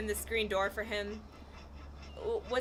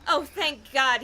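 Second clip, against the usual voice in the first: higher, loud cry of relief; raised, louder exclamation; 5 semitones higher, 7 dB louder, slower than usual. A narrator's reading. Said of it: "he cried".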